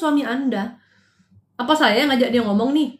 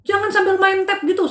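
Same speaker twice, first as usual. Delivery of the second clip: imitating a scolding mother, pitched higher; mock scolding; 6 semitones higher than usual. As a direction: replay the brief scold at her child, raised pitch. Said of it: "kayak orang marah gitu". (ibu memarahi anaknya sebentar, nada omelan tinggi)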